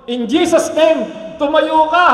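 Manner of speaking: slow, loud commanding call to rise. Pretend. Pastor acting out raising the dead, loud command. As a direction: order the dead to stand up.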